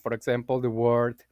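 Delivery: ordinary delivery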